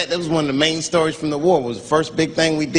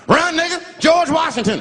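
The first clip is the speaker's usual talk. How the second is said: panicked warning. Retold or imitated. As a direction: yell at your friend to flee. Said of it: "I'd be on the other side like".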